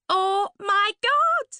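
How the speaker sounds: put-on high, breathy-bright posh young woman; a high, gushing party girl; Suzanne's gushing voice. To Laurie at the party, a high gushing voice.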